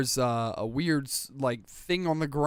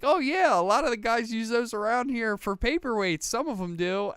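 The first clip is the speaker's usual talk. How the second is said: high, chirpy, offhand clerk voice; breezy desk clerk; 9 semitones higher than usual. Retold or imitated. Retelling the clerk shrugging off Infinity Stones, high and breezy. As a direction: toss it off lightly, way up high. Desk guy casually explains paperweights.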